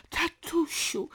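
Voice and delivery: high, slow plaintive call; drawn-out whimpering appeal, falling at the end; tearful cry. A narrator's reading. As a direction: plead with a whine of tears. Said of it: "krzyknęła Teresa płaczliwie".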